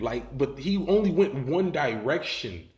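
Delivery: plain reading voice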